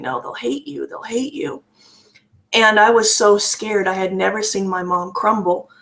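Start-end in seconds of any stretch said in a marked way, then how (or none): 0.0-1.6 higher, softer imitation of tearful pleading; understated bawling, voice lifted and hushed; retold bawling. Mother breaking down as daughter tries to call grandparents, mock bawling. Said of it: "then she starts bawling".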